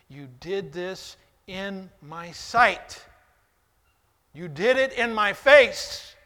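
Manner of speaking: building from firm to a loud, high shout; rising fury. Acted. Preacher shouting God's rebuke at David, angrily. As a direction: let God's anger build to a roar.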